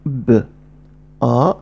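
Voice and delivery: ب then آ, each separately; sounds apart. Shown as a demonstration. Teacher splitting the syllable: ب, then آ. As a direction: say ب, pause, then آ. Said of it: "نطقت كل صوت لوحده".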